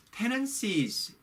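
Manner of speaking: tenancies drawn out slowly, stress on first syllable; pronunciation demo of tenancies; slow model word. Shown as a demonstration. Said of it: "계약서에 tenancies".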